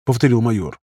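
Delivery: ordinary delivery, nothing marked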